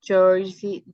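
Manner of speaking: thick North Jersey accent demo; a demo of the New Jersey accent. Shown as a demonstration. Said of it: "Let me hear you say things A W K".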